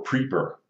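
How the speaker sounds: PREEPER with a long EE; misspelling read literally. Retold or imitated. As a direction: say PREEPER exactly as misspelled, PREE-per. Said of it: "It actually says preeper".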